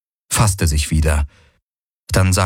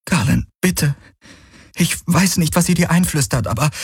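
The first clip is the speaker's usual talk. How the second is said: put-on higher young woman's voice, hurt and reproachful; Kirai pleading; 9 semitones higher than usual. Kirai confronting Galen about his obsession, hurt and pleading. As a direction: plead with him, rushing the words.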